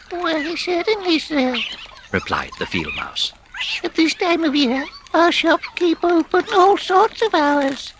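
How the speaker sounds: very high, tiny, polite voice; polite field mouse. A narrator's reading. Field mouse answering Rat, very high and polite. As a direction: squeak out a helpful answer.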